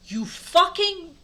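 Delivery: loud, high, drawn-out insult; angry shout. Acted. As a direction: yell it in hammed-up fury. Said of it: "I shouted angrily".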